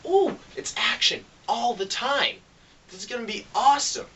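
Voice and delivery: high, eager movie-fan gush; pumped up for nonstop action; hyped anticipation. Retold or imitated. Moviegoer before the film imitating his own high hype. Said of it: "you're just gonna be like".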